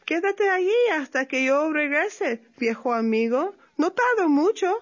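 light sly opossum voice; lightly voiced opossum. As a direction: play the little opossum lightly. Tlacuache voiced in story.

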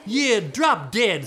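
slow, loud, heavy insolence; rude retort. Acted. Cursed sister backing up her sister, slow loud rudeness. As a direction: stretch and punch each word.

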